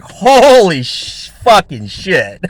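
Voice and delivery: slow, drawn-out startled curse; half-laughing at his own fright; spooked swearing. Retold or imitated. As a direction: let the shock stretch the words out. Seeing the light switched off.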